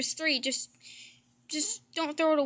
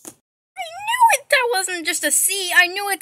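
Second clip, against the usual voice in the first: triumphant discovery; 6 semitones higher, 7 dB louder than usual. Acted. Sibling to kid caught hiding books, triumphant and high. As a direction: crow that you caught them. Discovers the hidden fairy tales.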